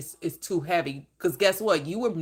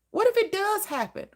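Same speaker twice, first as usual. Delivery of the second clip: "What if it does happen?" pitched much higher, "What" stressed; high emphatic question; 11 semitones higher than usual. Shown as a demonstration. Preacher challenging worriers, "What if it does happen?" high. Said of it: "repeated with growing emphasis".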